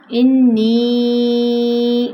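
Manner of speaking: drawn-out recitation of انی; long madd. Shown as a demonstration. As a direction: stretch the vowel of انی very long and steady. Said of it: "مد کی وجہ سے لمبا ہے".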